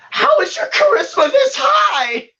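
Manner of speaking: high, incredulous mock yell; acted yell. Clerk reading the charisma score, shrieked disbelief. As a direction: cry out in high-pitched disbelief. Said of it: "visibly yells out".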